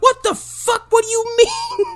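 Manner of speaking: very high mock screech of disbelief; stunned, high-pitched outburst; shocked surprise. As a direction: squeal it in hammed-up shock. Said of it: "It's a surprise of".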